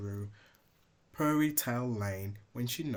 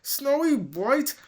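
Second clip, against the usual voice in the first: high, loud outraged cry; outraged shriek; 12 dB louder, slower than usual. Acted. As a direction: explode on the name. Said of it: "Kingsley hissed".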